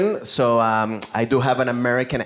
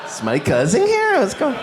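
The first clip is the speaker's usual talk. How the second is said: mock surprise; 11 semitones higher than usual. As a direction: react like you've been pranked. Shocked at the cup.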